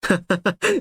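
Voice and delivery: short, high giggle; amused giggle. Real, not acted. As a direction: giggle lightly before speaking. Amused by the posted art.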